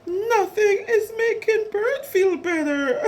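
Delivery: high, loud mock-tearful wail; crying wail. A narrator's reading. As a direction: bawl it out for a storybook, hammed-up. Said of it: "cried Bird's friends together".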